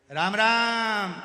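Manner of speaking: slow, very loud hospital greeting; loud drawn-out shout. Retold or imitated. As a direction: bellow the greeting slowly, like a deaf man. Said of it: "वो चिल्ला के बोलता है".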